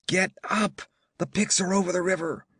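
shouting a warning through the door; urgent shout. Acted. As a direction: rouse the sleepers, call it out fast. Warning sleeping settlers at the door.

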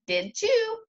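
put-on very high innocent toddler question; very high voice of a little girl. A narrator's reading. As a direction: ask it high like a small child.

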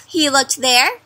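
usual speaking voice

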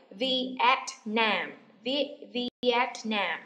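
"Vietnam" slowly, syllable by syllable, weight on Nam; "Vietnam" drawn out as viet-NAM; stress on last syllable. Shown as a demonstration. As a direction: lean on the end of "Vietnam". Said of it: "Nhấn vần phía sau của chữ Nam".

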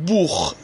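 بوخ with a long u and final throaty خ. Shown as a demonstration. Teacher introduces the word for book, ch as kh. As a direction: say German "Buch" as بوخ, "bookh". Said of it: "باید خ خونده بشه".

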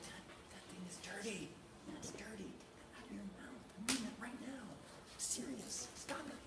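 firm whispered scolding with no voice at all; voiceless scolding. Shown as a demonstration. Dad scolding his toddler silently, in a firm whisper. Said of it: "I would turn my voice off".

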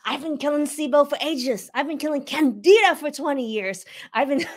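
hammed-up voice of someone stuck killing sibo and Candida; mock frustrated patients. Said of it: "How many of you out there are like".

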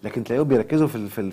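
usual speaking voice

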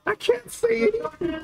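high, exasperated cry; exasperated outcry. Real, not acted. Caster who can't see the match, exasperated. Caster loses sight of game.

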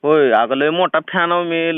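ordinary delivery

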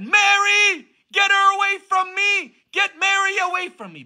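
very high, strained shriek; imitated demon screaming to be left alone; screaming devil. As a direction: scream in horror like a cornered devil. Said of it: "the devil started screaming".

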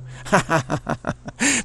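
high, rolling laugh; laughter. Real, not acted. Speaker amused by the other driver's excuse, laughing high.

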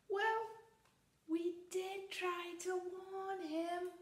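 high, slow matter-of-fact chicks; high innocent chick voice; chicks explaining. Acted. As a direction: say it like little chicks who warned him.

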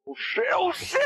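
high falsetto panic; panicked squeal. Real, not acted. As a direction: squeak oh shit in alarm. Panicking in fight.

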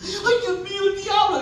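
high-pitched startled yelp; comic panicked gasp of words; mock fright. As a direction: wake up terrified, hammed up for laughs. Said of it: "se asustan".

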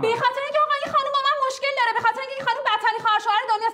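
fast, heated torrent of complaint; angry rant. Acted. Wife ranting fast at the lawyer about her sister-in-law. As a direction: rattle off the grievances fast and hot. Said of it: "اینقدر داد نزن".

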